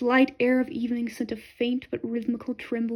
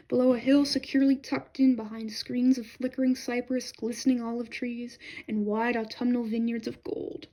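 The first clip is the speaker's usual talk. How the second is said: usual speaking voice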